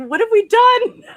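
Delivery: high, loud laughing cry of dismay; laughing what-have-we-done shriek; mock horror. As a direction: wail it in mock panic. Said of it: "you're just like".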